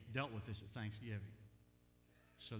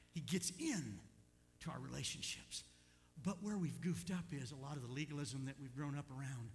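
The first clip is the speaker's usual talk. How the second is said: usual speaking voice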